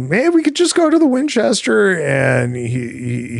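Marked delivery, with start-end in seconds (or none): 0.1-1.9 much higher, quick, chirpy pitch; hopeful suggestion. Retold or imitated. Boyfriend suggesting the pub to girlfriend, high and eager. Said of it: "talk about, you know".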